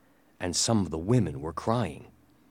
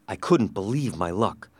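plain reading voice

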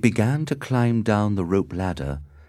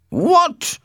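high, loud outraged father; explosive, high-pitched outrage; angry shout; 7 dB louder than usual. A narrator's reading. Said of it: "shouted Capulet angrily".

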